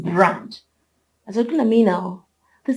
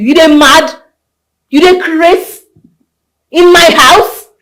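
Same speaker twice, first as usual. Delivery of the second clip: loud, high, incredulous challenge; outraged scolding; 3 semitones higher, 8 dB louder than usual. Acted. Householder threatening her meddling friend, outraged scolding. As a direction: hurl each question in outrage.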